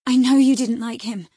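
high, loud, eager appeal; slightly repressed delight. A narrator's reading. Wife reasons with reluctant husband, delight slightly held back. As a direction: rein in intense joy only a little. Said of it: "repressing a little the intensity of her delight".

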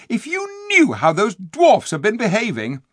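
indignant. Acted. Eustace indignant about dwarfs.

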